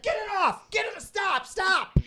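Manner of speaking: high, frantic cries to stop; patient in the chair begging the staff to stop; panicked pleading. Retold or imitated. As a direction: beg them to get it off. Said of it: "I was like".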